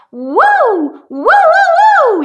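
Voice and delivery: light head voice sirens; head voice woo. Shown as a demonstration. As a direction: float it up into the head. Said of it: "just say".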